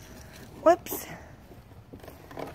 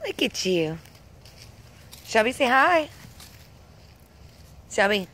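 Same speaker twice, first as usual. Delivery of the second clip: normal talking voice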